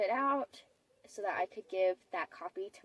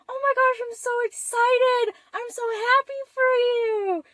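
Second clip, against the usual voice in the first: imitating gushing dad; 8 dB louder than usual. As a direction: gush like a thrilled dad, pitched way up. Dad gushing over the news.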